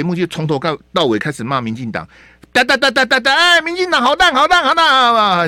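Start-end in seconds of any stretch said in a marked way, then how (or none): 2.5-5.5 high, loud hammed-up rant; hammed-up host bashing the party on air; mock ranting host. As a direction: parody a partisan talk-show host. Parodying partisan show host.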